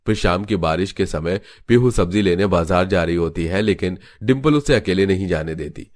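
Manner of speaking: plain reading voice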